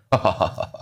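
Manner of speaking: low, soft ha-ha-ha; imitated teacher's laugh. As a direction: fake a low, quiet chuckle. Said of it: "And he starts laughing".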